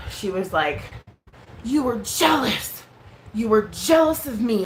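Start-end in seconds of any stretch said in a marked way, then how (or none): 1.6-4.7 higher, drawn-out imitation of an accuser; mock accusation. Streamer accusing the speaker of jealousy, mock indignation. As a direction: hammer "jealous" in a mocking quote. Mocking retelling of her accusation.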